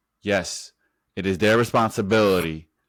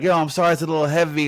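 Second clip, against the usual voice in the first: lightly mimicked apology, pitched up and louder; imitating movie character; 8 semitones higher, 4 dB louder, faster than usual. Retelling the movie scene to a friend, mock high apology. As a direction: act out an apologetic movie line.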